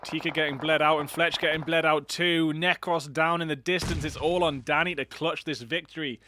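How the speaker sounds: loud, fast esports commentary; excited play-by-play. Acted. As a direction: call the fight live with rising energy.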